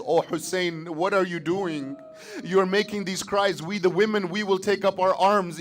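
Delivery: high, loud, urgent pleading; a sister crying out in alarm; sister's distressed outcry to her brother. Acted. Zainab cries out to Hussein.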